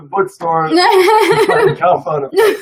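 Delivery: high, laughing quote of a friend; Chrissy laughing. Retold or imitated. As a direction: giggle through her excited words. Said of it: "Chrissy said".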